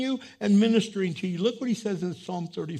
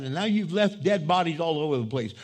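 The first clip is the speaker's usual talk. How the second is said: ordinary talk, nothing marked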